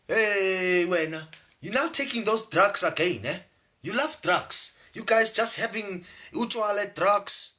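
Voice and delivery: high-pitched, accusing African mother; scolding mom. Acted. Mom dismissing her child's dream as drugs, scolding high.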